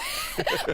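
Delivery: bright, high laugh; kind gentle laugh. Real, not acted. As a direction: laugh it off warmly. Reporter laughs at her snowman.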